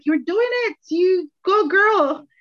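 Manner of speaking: put-on high, slow cheer of you go girl; a hint of friends cheering her on. Friends cheering her on as a single mother, high and drawn out.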